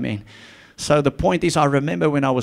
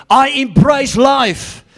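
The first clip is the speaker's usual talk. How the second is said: higher, louder, slow preaching build; fiery preaching; 8 semitones higher, 6 dB louder, slower than usual. Acted. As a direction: proclaim it to the church, rising. Preacher rising again.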